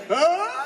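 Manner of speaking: high yell losing air like a balloon; deflating yell. Shown as a demonstration. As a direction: let it sag like air leaving a balloon. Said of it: "sounds like a balloon and letting the air out".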